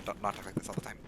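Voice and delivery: usual speaking voice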